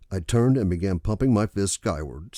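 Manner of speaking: ordinary delivery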